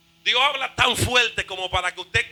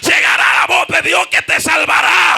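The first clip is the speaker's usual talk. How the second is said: loud, high-pitched revival shout; full-force preaching; 9 semitones higher, 13 dB louder than usual. Real, not acted. Preacher promises congregation God's saving voice, shouted at full force.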